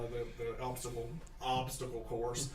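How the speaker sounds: ordinary delivery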